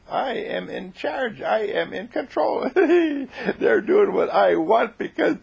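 high, slow triumphant crowing with a giggle; hammed-up giggly gloat. A narrator's reading. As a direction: crow over your secret power. John gloats over secret control.

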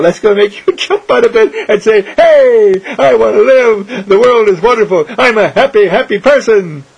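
high, loud, laughing cheer; joyful outburst. Acted. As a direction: burst out with giddy joy. Acting joyful Irene's cry.

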